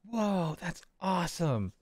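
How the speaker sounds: put-on slow, marveling kid voice; awed kid. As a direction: draw it out like an impressed child. Child in awe of the trick.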